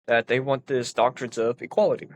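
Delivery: ordinary talk, nothing marked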